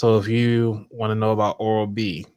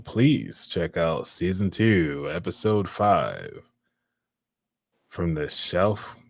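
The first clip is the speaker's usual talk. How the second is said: radio voice; 4 semitones lower than usual. Shown as a demonstration. Host showing off for guests how they'd plug the show, radio voice. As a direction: put on a deep radio promo voice, drawing out the titles. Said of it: "my radio voice together".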